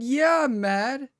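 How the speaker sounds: high, slow, drawn-out mock anger; playfully mad. Real, not acted. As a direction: stretch it out, half-joking outrage. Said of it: "[name], you mad?".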